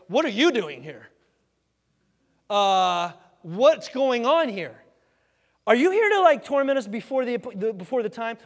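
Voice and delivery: loud, high-pitched mock alarm; freaked-out demons. Demons caught off guard by Jesus, freaked-out questioning. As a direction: act thrown by an unexpected arrival, voice jumping up. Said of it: "These demons are freaked out".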